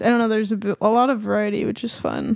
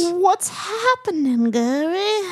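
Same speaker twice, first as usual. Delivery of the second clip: higher, very slow, drawn-out greeting; guest-host character; 5 semitones higher, much slower than usual. Acted. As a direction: stretch each word as a silly co-host. Plays the second Gary.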